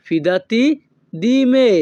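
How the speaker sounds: slow split demo. Shown as a demonstration. Teacher to viewers, broken-up model phrase. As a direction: break it up for repeating. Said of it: "তখন আমাদেরকে বলতে হবে".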